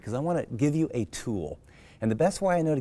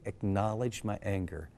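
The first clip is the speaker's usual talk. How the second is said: normal talking voice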